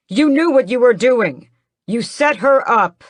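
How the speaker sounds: high, very loud accusing shout; angry accusation. Acted. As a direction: hurl the blame at her. Raoul accuses his mother.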